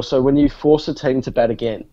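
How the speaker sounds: usual speaking voice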